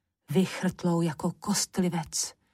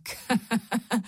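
short, higher gloating laugh; witch cackle; 4 semitones higher than usual. A narrator's reading. Witch gloating over petrified Mikeš, short cackle. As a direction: cackle in triumph.

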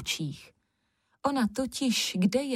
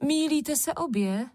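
calling out; 7 semitones higher than usual. A narrator's reading. Sister telling the other two off, high exclamation. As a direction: exclaim it high, correcting both. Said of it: "zvolala Červenka".

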